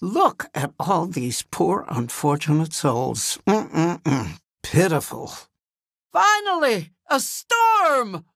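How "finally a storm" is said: high, gloating cry with falling ends; exultant villainess. Acted. Sea witch greeting the storm at last, exultant.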